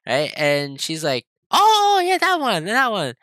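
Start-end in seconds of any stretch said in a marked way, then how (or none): 1.5-3.1 mock dawning realization, pitched way up; sudden recognition. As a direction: imitate mom finally getting it, loud start then settling. Mom suddenly remembers the car.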